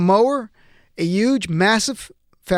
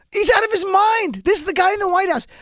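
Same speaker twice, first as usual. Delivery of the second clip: very high, incredulous exclamation; outraged disbelief; 10 semitones higher than usual. Real, not acted. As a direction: pitch way up in real outrage. Outraged at Trump's tweet.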